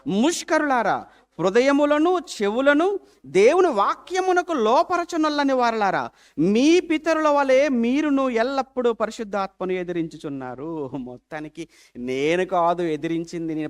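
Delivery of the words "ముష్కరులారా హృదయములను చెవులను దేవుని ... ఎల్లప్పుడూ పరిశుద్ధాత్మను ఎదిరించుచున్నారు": slow, loud, higher rebuke; stern warning. Shown as a demonstration. Preacher reads Stephen's warning to the council, slow and stern. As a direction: thunder each word slowly at the stubborn hearers. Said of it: "ఈయన హెచ్చరికతో ఏమంటున్నాడంటే".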